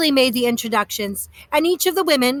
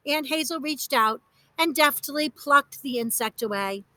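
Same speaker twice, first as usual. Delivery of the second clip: plain reading voice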